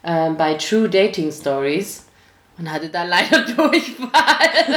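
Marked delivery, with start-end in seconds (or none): 2.6-4.8 laughing. Real, not acted. Embarrassed admission, laughing.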